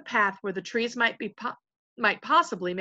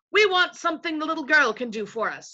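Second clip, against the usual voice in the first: lightly voiced, higher-pitched chief; chief invisible voice; 8 semitones higher, 4 dB louder than usual. A narrator's reading. Invisible chief demanding Lucy's help, bluntly. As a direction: state the demand in a rustic leader's voice.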